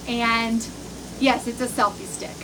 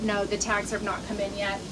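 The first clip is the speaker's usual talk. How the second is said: quieter, deflated grumble; resigned repeat; 6 semitones lower, 10 dB quieter than usual. Real, not acted. Annoyed about late tags, muttering it again quietly. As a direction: lets the complaint sink, softer and lower. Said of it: "so I'm a little irritated with that".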